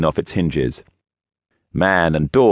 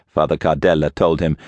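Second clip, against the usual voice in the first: plain reading voice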